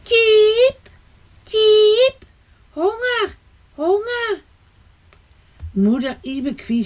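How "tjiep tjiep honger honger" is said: high chirping chick voice; hungry baby bird. A narrator's reading. Baby sparrow begging his parents for food, high chirps. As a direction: cheep like a starving nestling, pitched way up.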